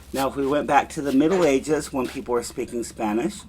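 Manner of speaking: usual speaking voice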